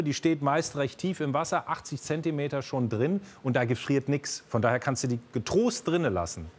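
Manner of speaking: usual speaking voice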